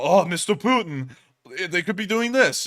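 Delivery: mock Russian voice. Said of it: "then they go and go".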